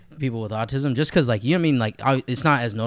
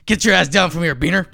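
louder, higher mock yell of an angry boss; 5 semitones higher, 5 dB louder than usual. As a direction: bark it out like a mock threat. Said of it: "They'll probably come in and be like".

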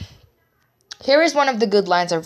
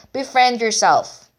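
announcing; 6 semitones higher, 6 dB louder, slower than usual. Real, not acted. Announcing point title.

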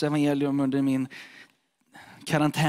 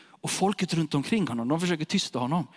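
usual speaking voice

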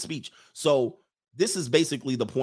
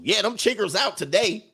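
high mock Southern voice; 8 semitones higher than usual. Imitating a Southern local.

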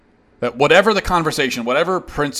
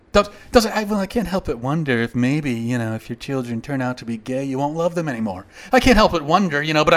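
mock meek. Mimicking a polite critic's insinuation, in a hint of a sugary voice. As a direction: put on a meek, mild, fake-concerned voice. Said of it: "in your nice little pleasant way, your meek and mild way".

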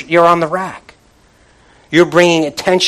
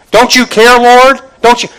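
high and loud, fed-up pleading; exasperated cry to the Lord; frustrated plea; 8 semitones higher, 9 dB louder than usual. Retold or imitated. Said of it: "Martha gets all frustrated".

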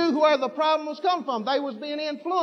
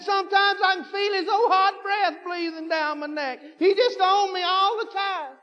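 mock tearful plea; 7 semitones higher than usual.

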